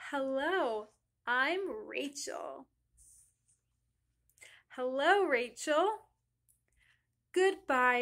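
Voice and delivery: slow, deliberate storybook reading; reading to children. A narrator's reading. Teacher reading a greetings story to class, slow read-aloud. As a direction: read each greeting slowly, with clear pauses.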